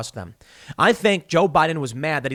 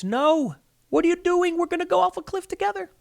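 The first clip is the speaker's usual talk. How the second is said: mock screaming. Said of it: "you're screaming in their face".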